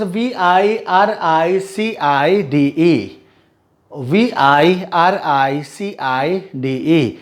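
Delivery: letters spelled slowly, one by one; slow spelling out. Shown as a demonstration. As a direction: dictate each letter slowly for the class. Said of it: "मैं सिर्फ spellings पढ़ूंगा".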